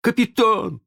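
high, loud joyful call; gleeful shout to the boss. A narrator's reading. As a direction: hail the captain in high delight. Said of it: "Радостный голос неряхи вывел его".

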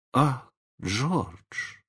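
high, sighing, drawn-out dismay; sighing reproach. A narrator's reading. Wife discovering her husband's trick, sighing reproach. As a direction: breathe out the name in gentle reproach.

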